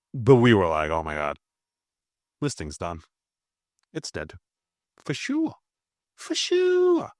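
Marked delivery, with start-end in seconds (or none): 0.9-4.3 quiet, low, deflated quote; mock resignation. We tell each other it's over, in quiet mock resignation. Retelling doubters who gave up. 5.0-7.1 very high squeaky mimicry; mock certainty. Doubters agreeing that Bitcoin is dead, in high-pitched mock certainty.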